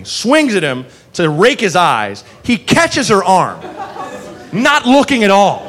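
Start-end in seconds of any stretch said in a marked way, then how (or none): none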